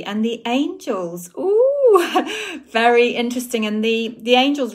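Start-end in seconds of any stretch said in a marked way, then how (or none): 1.4-2.7 high, loud delighted ooh into a laugh; excited ooh. Real, not acted. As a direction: squeal with delight, then laugh. Excited about her podcast.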